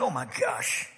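near-whispered disbelief; hushed dismay. Retold or imitated. Preacher reacting to sunburned fisherman, hushed dismay. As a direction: gasp it softly, under your breath. Reacting to fishing all day.

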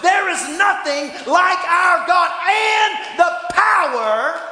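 rising, loud sermon cadence; fiery preacher building to a shout; preaching. Real, not acted. Preaching at full force.

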